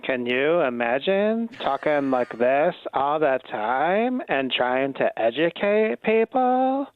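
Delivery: man doing a woman's voice; girl voice. Retold or imitated. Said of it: "I enjoyed [name]'s girl voice".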